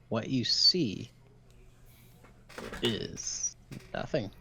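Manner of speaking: slow, drawn-out game master reveal; suspenseful reveal. Pretend. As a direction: stretch it out with a long pause before the letdown. Game master reveals failed search result.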